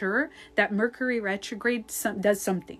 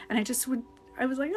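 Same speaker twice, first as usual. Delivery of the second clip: ordinary delivery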